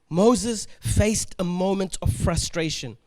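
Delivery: usual speaking voice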